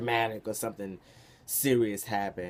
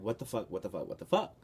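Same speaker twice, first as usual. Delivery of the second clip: shocked disbelief. Retold or imitated. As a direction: wake up stunned and panicking. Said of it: "I woke up kinda like".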